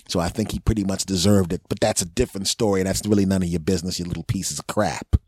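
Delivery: ordinary talk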